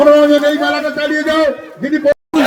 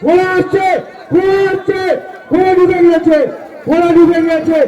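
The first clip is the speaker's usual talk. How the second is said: race caller; 4 semitones higher than usual. Real, not acted. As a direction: race call at the finish. Horses crossing the line.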